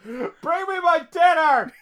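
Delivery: high, slow, whiny demand; mock bossy son. Grown son bossing his father around for dinner, slow whiny demand. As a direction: drag it out like a spoiled teen.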